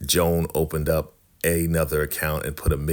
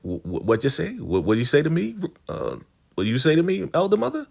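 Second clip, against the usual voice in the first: mock angry stammer; 10 semitones higher than usual. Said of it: "Elder mother's getting extremely mad".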